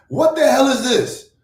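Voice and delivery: high, loud disbelieving shout; shocked outburst. Real, not acted. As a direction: yell in real disbelief at the title. Shocked at his own title.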